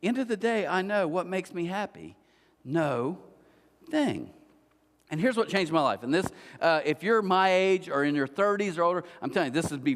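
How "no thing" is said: "No thing" with a long pause between the words; split into two words. Shown as a demonstration. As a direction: let "No" stand alone, then "thing". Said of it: "I spelled that wrong, though".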